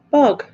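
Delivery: north-east dialect Bog, clipped and bright; dialect word said alone. Shown as a demonstration. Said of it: "We say bog".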